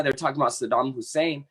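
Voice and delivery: normal talking voice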